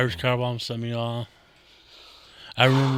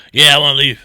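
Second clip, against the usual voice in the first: put-on loud, slurred drunk insistence; 2 semitones higher, 14 dB louder than usual. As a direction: play the stubborn drunk. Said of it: "I was pulling that drunk".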